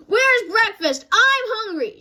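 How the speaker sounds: Papa hamming up a hungry cub; shouted. A narrator's reading. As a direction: holler for breakfast like a cub. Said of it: "shouted Papa".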